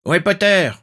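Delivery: loud, high battle yell; shouted demand. A narrator's reading. A Death Eater hunts Potter in battle, loud high shout. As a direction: shout the question across the fray. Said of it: "Un mange-mort cria".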